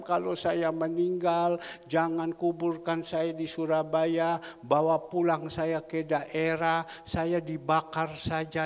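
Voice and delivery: slow, loud, drawn-out recital of a message; reciting last wishes. Retold or imitated. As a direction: spell out each wish slowly and emphatically. Reciting a sick man's last-wish message. (membacakan pesan dengan lambat dan lantang)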